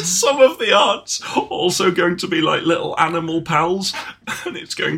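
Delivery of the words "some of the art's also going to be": ordinary talk, nothing marked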